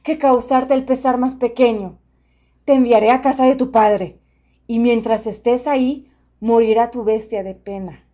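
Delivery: loud, booming gentle beast; friendly monster voice. A narrator's reading.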